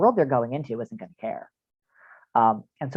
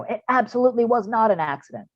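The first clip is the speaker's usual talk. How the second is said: put-on defiant kid; 8 semitones higher than usual. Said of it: "some kids are gonna go".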